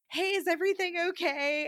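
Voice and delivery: lightly voiced high, sassy waiter. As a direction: check in with a hint of sass. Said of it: "he's this very sassy man".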